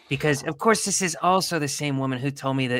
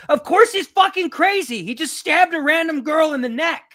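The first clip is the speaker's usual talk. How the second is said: outraged; 13 semitones higher, 5 dB louder than usual. Real, not acted. Outraged at the killer's defence.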